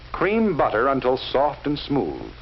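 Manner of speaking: usual speaking voice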